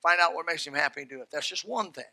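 ordinary delivery

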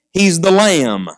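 normal talking voice

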